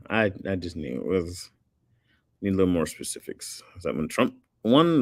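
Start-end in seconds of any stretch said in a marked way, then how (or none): none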